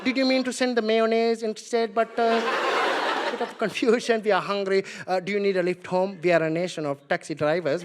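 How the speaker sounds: put-on measured, polite comic Indian accent. Hungry Indians addressing their British colonizers, comic Indian accent. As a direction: make a deferential complaint in an Indian accent. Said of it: "they're like".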